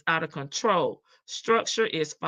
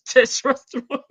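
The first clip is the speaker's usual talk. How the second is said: laughing; 8 semitones higher than usual. Real, not acted. Laughing at the word.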